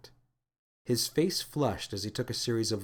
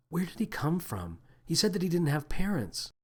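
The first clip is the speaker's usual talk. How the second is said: hesitant, soft question; 6 semitones higher, 3 dB quieter than usual. A narrator's reading. Lily asks anxiously.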